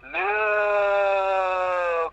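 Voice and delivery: hammed-up outcry; over-the-top yell as the pony character; mock cry. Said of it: "cried Twilight".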